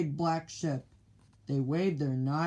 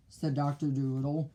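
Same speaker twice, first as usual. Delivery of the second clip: usual speaking voice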